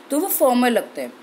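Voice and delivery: ordinary delivery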